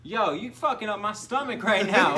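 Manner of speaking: high, loud, on the edge of laughter; mock disgusted outburst. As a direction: imitate a protest, nearly cracking up. Acting out disgusted man, amused.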